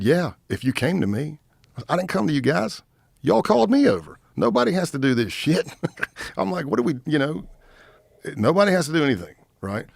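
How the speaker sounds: indignant comeback; heated retort. Retold or imitated. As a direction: protest sharply, rising in pitch. Retold heated argument with outlaws.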